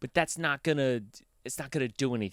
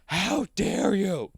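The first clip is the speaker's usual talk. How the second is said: slow, high, louder mock-offended gasp; 1 mock outrage; 9 semitones higher, 5 dB louder, much slower than usual. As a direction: ham up the shocked outrage. Mock outrage at his greeting.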